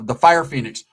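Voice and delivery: normal talking voice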